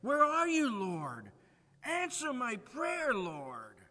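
anguished call to the Lord, rising loud; pleading cry. Acted. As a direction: cry out to God in desperation. Pleading prayer opening sermon.

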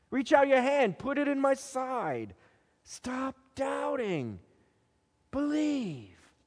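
high, soft, unhurried appeal; gentle invitation. A narrator's reading. Jesus invites doubting Thomas to believe, gently. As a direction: coax softly, lifting the pitch.

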